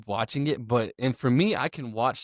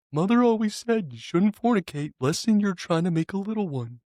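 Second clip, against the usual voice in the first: high-pitched creepy impression; prim mother-quoting killer, pitched way up; Ed Gein; 8 semitones higher than usual. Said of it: "say something in the Ed Gein voice".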